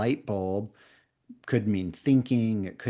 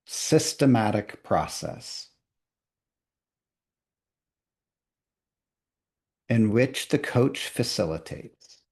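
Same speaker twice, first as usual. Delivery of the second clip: very slow, long pauses between each phrase; really slowly; slower than usual. Shown as a demonstration. Teacher dictating very slowly so trainees can draw. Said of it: "I'm gonna do it really slowly".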